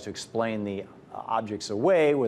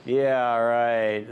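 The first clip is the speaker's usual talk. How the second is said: sarcastic; 4 dB louder than usual. Real, not acted. Mocking the official explanation.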